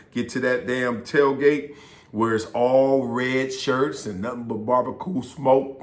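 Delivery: ordinary talk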